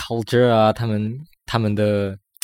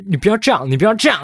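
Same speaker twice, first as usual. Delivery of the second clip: mock Taiwanese accent; 9 semitones higher than usual. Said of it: "你的那个台湾腔调就是".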